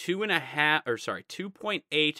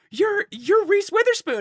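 starstruck gushing; 9 dB louder than usual. Retold or imitated. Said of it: "And she was like".

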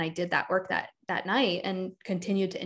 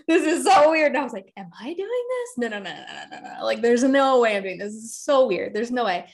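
retold disbelief; 3 semitones higher, 4 dB louder than usual. Retelling her own shocked reaction.

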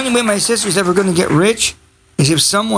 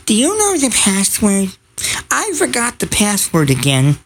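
a hint of a clueless sister; imitating sister. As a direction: lightly mimic a forgetful caller. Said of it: "she'll call me up and say".

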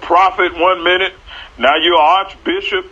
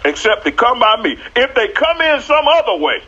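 preaching Jesus voice; 4 semitones higher than usual. Retold or imitated. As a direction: proclaim it like a preacher at full force. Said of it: "Jesus said".

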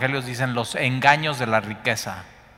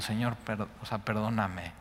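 soft prayer; 2 semitones lower, 8 dB quieter than usual. Retold or imitated. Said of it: "ayer llorando y digo".

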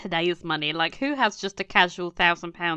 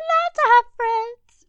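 high, whining mimic of her; whiny mock voice; 7 dB louder than usual. Mocking Demelza's complaint.

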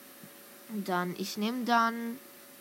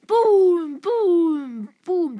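loud, high, drawn-out booms; triumphant shout; 8 semitones higher, 11 dB louder, much slower than usual. Real, not acted. Gamer celebrating a team knocked out, triumphant shout. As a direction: boom it out in victory.

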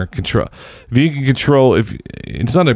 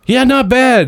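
the guy's answer; 14 semitones higher, slower than usual. Retold or imitated. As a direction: toss it off like an insider. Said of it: "And he goes".

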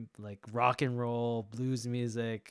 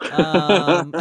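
laughing; 5 semitones higher, 12 dB louder than usual. Real, not acted. Laughing at "subjective term.

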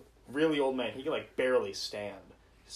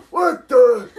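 loud, high shocked exclamation; an old man jolted by a scream; startled what the; 12 semitones higher, 19 dB louder than usual. Retold or imitated. Old man startled in the gas station bathroom, a loud high what the. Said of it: "And he goes".

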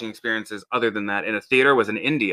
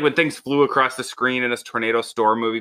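ordinary delivery

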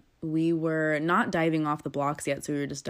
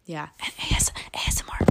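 ASMR whisper; faster than usual. Shown as a demonstration. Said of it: "We're gonna do the whole episode just like this".

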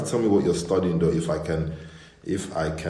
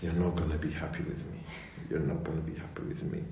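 fast, soft, whispered repeat; mock her hushed way of saying it; imitating her hushed plea; 2 semitones lower, 10 dB quieter, faster than usual. Imitating Binta's fast whispered plea. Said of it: "she does this thing".

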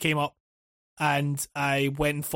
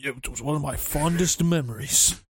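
mock solemn reply; 4 semitones lower than usual. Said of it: "It was like".

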